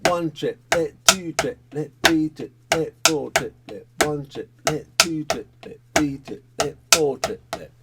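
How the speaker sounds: rhythmic chant with space between the syllables. Shown as a demonstration. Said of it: "Do a bit slow".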